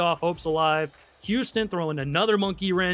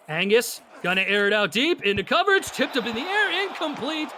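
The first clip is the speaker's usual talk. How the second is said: excited final call; 4 semitones higher than usual. Real, not acted. Announcer calling the game's final play, rising excitement. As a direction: voice climbs much higher on the tipped ball.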